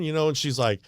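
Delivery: ordinary talk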